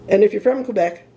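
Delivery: ordinary talk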